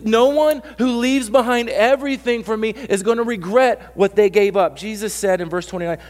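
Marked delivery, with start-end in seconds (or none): 0.1-4.8 high, loud insistence repeated; repeated emphatic. Shown as a demonstration. Pastor repeating the promise for the doubter, loud. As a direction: hammer it again, loud and high. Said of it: "you don't understand. I went".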